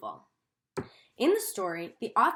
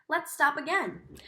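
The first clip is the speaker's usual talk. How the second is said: usual speaking voice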